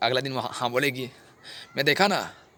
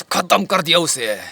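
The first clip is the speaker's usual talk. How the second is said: loud, slow, drawn-out declaration; vehement confession; 3 semitones higher, 7 dB louder, slower than usual. Acted. Killer declares the killing to officer, loud and slow. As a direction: hammer it out slowly and loudly.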